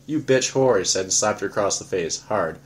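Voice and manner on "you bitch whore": angry insult. Acted. Pete slaps Lily.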